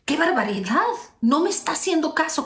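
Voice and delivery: high, put-out mock outrage; mock indignation. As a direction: play the ignored partner, offended. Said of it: "esa persona dice".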